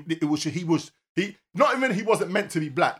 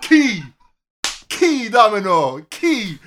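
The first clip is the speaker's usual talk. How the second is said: loud, drawn-out shout of the word; excited shout; 3 semitones higher, 10 dB louder, much slower than usual. Real, not acted. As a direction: call it out slowly across the room. Calling out excitedly.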